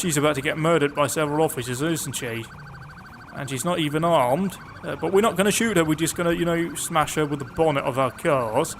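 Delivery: normal talking voice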